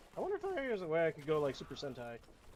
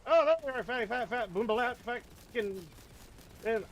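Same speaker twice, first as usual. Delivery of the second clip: high, loud mocking taunt; 7 semitones higher, 3 dB louder than usual. Real, not acted. Gamer finding the enemy, taunting loudly. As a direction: gloat at the enemy, singsong.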